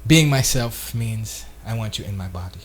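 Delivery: mock seductive low line; low soft final line. Shown as a demonstration. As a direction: drop it low like the punchline. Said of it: "then you say".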